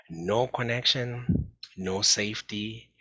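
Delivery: slow stressed warning; slow emphasis. Real, not acted. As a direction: lean hard on no connection and no safety, slowly. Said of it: "slow emphatic key phrase".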